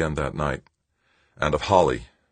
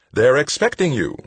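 high, louder official send-off; insistent sweetness; 9 semitones higher, 5 dB louder than usual. A narrator's reading. Woman dismissing Tavalera to his assignment, insistently sweet. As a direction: send him along in a raised, sugary voice.

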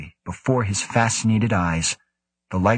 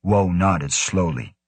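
usual speaking voice

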